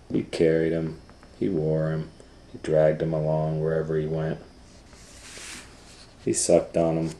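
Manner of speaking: ordinary delivery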